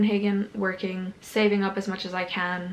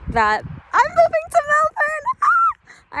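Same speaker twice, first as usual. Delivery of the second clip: excited; 8 dB louder, slower than usual. Real, not acted. Announcing her big move, thrilled.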